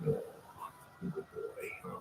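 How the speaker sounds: breathy whisper to a good dog; just whisper it to the dog; whispered praise. Real, not acted.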